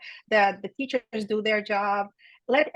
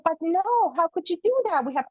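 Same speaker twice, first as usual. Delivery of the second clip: higher protesting friends, lightly voiced; mock dismay, voice pitched up; 6 semitones higher than usual. Friends protest the mother leaving lunch, mock dismay.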